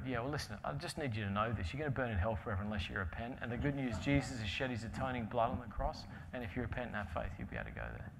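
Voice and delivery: low, soft, matter-of-fact delivery; awkward evangelistic pitch. Retold or imitated. Believer telling a workmate about hell after small talk, low and understated. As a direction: drop the voice and slip the gospel in.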